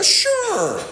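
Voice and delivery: very high, drawn-out agreement; squeaky-high, readily conceding; exaggerated concession. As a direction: stretch it out way up high. Exaggerated concession answering own question.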